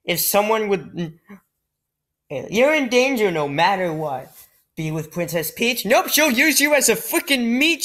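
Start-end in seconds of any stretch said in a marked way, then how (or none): none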